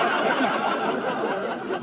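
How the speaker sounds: unvoiced airy laugh; soft breathy chuckle. Real, not acted. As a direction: laugh out silently under the breath. Laughing at himself.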